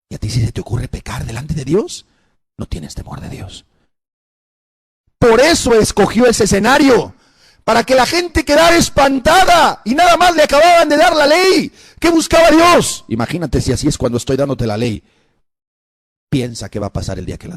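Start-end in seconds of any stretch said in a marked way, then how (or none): 5.2-13.1 high, loud preaching shout. Real, not acted. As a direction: thunder it at the congregation. (predicación a gritos, voz alta y fuerte)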